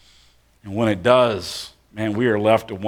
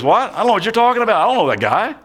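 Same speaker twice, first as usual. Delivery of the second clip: much higher, defensive brush-off; panicked denial; 10 semitones higher, 3 dB louder, much faster than usual. Acted. Peter to the bystander denying Jesus, high flustered denial. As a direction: wave the accusation away fast.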